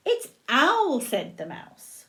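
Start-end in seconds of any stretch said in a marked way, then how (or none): 0.1-1.0 high, lilting reveal; sing-song storybook excitement; bright announcement. A narrator's reading. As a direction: announce the newcomer to small children. Mouse announces owl in story.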